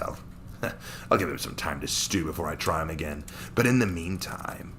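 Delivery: a cruel Saiyan amused by his prey; sneering villain. Acted. Villain Daikon monologuing alone.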